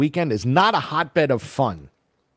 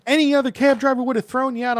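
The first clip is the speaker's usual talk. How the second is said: much higher, fast mocking of the driver; 12 semitones higher, faster than usual. Teller mimics the Uber driver's jab, high and fast. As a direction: parrot her line back mockingly.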